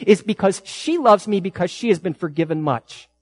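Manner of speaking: Jesus confronting his host, preached with fire; impassioned preaching. Acted. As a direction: press the point home to Simon. Jesus rebukes Simon, preached.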